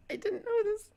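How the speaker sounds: high, squeaky through laughter; laughing. Real, not acted. Vlogger admitting he was overheard, laughing high. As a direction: barely get it out while giggling.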